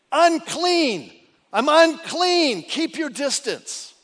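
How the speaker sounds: loud warning cry, high and strained; calling out unclean. Retold or imitated. Leper warning passers-by, loud call. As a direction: call it out across the distance. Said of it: "you had to call out and say".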